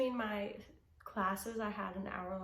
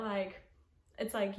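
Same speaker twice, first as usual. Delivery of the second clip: usual speaking voice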